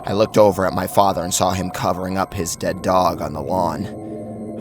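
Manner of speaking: ordinary delivery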